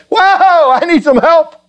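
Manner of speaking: startled high-pitched yelp for help; alarmed cry for help. Retold or imitated. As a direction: call out like he's pinned down and needs backup. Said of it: "he could sense this and say".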